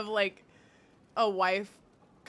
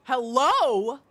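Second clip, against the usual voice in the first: calling out; 6 semitones higher, 13 dB louder, much slower than usual. Real, not acted. Calling out to unresponsive game.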